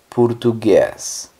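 "portugués" slowly as "purtuguês", first vowel a u; Portuguese pronunciation. Shown as a demonstration. Teacher models the Portuguese word, "portugués" as slow "purtuguês". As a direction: say "portugués" Portuguese-style, drawn out with "pur-". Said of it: "portugués se diría".

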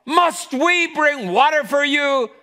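high, loud angry demand; frustrated shout. Acted. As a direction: yell at the crowd in exasperation. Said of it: "Moses is frustrated".

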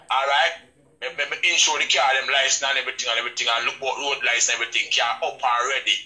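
ordinary talk, nothing marked

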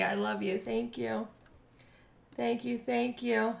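quiet moved thanks; soft sincere thanks. Real, not acted. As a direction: hush it, heartfelt. Softly thanking a donor.

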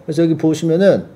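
ordinary delivery

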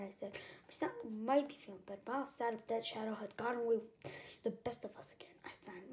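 plain reading voice

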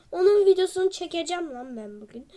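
usual speaking voice

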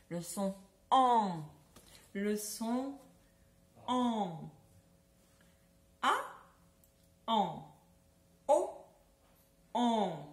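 Le son en drawn out slowly, en fully nasal. Shown as a demonstration. Teacher slowly modelling en for children. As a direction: stretch the en so children hear it. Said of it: "un peu nasal".

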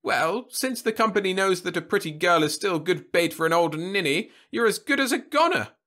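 put-on high, loud, sarcastic old man; mock her with heavy sarcasm. A narrator's reading. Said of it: "facetiousness and downright sarcasm".

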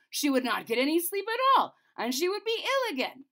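high, loud angry outburst; the king still raging. A narrator's reading. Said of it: "The king flew into such a rage".

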